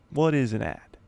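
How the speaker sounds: usual speaking voice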